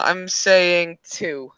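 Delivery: dragged-out, decisive call; slow deliberate call. Real, not acted. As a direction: stretch it out, deciding. Committing to the call.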